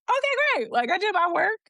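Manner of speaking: very high, squeaky-pitched mock delight; naively pleased. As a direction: play the easily satisfied novice, way up high. Acts out her naive would-be reply.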